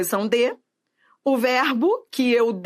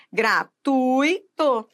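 "Gratuito" very slow, split gra-TUI-to; stressed syllable shown; much slower than usual. Shown as a demonstration. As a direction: lean on "tui" in a drawn-out "Gratuito". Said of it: "identificar a sílaba tônica".

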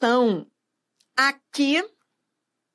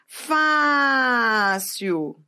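"Fácil" with a long drawn-out first syllable FÁÁÁ; stressed syllable shown. Shown as a demonstration. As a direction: hold the "Fá" of "Fácil", then drop away. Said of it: "identificar a sílaba tônica".

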